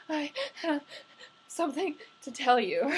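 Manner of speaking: choked crying voice, breaking; mimicked sobbing. To James on the phone, mock sobbing voice. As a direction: imitate sobbing out the news. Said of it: "I can pretty much mimic to you exactly how it sounded".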